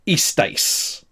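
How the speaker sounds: istais said "EE-stice"; careful pronunciation model. Shown as a demonstration. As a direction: istais set apart, ending like "ice". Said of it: "rehearse the pronunciation of this".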